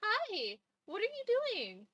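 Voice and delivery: pet talk. Real, not acted. Streamer greeting her cat, high pet talk. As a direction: coo it like to a pet.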